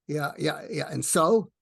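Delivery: put-on a fed-up old New Yorker from Brooklyn, pushing him away; annoyed Brooklyn-accented mentor grumbling at being woken; Larry King's Brooklyn accent. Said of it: "in his Brooklyn accent".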